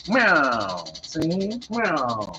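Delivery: a hint of an old movie gangster meowing; gangster cat. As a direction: meow like a tough guy, see. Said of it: "She talked like an old gangster".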